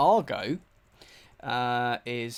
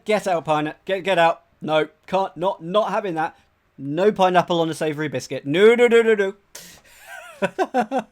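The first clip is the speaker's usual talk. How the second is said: mock outrage; 3 semitones higher, 9 dB louder than usual. Streamer reacts to pineapple pizza.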